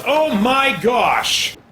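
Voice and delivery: normal talking voice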